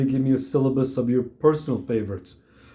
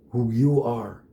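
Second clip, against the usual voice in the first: ordinary delivery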